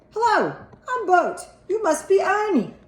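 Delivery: put-on high, chirpy puppet voice from a toddler; a small boy playing Bert for his father. A narrator's reading. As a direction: greet dad as a TV puppet. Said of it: "playing along with him".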